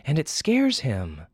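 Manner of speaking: a stern mother explaining; scolding mother. Acted.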